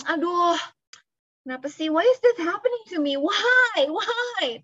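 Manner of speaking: hammed-up self-pity; self-pitying whine. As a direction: wail it like a martyr feeling sorry for herself. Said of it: "self pity".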